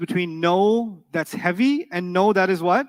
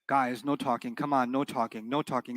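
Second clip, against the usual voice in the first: quiet, lower, half-hearted "no talking"; soft, lower no talking; 5 semitones lower, 6 dB quieter than usual. Shown as a demonstration. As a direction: mumble "no talking" with no authority. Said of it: "when I talk like that".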